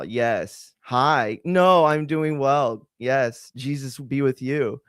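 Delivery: slow, careful, polite phone voice. Shown as a demonstration.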